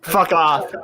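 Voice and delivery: exasperated, half-laughing protest; exasperated outburst. Real, not acted. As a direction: bat away the teasing, loud and high. Mock outburst at a teasing question.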